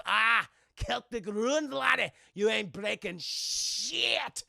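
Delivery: slow, loud Scottish gloat; boastful Scotsman. Retold or imitated. Scotsman's sword survives Jack's blow, gloating. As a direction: stretch it out as a cocky Scot.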